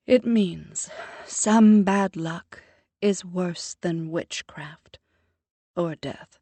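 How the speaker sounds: slow, thick Jamaican lilt; Jamaican accent. A narrator's reading. Mother explaining the proverb to her daughter, in a Jamaican accent. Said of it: "She strengthens her Jamaican accent".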